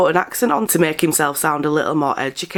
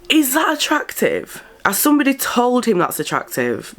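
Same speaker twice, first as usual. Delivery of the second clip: incredulous; 10 semitones higher than usual. Real, not acted. Incredulous at the video.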